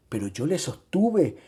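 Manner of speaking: ordinary delivery, nothing marked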